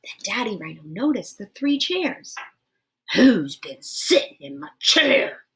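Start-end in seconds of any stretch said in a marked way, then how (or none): 3.1-5.4 great big roar. Acted. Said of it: "roared Daddy Rhino in his great big voice".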